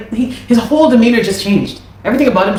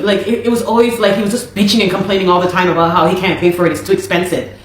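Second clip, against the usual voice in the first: ordinary delivery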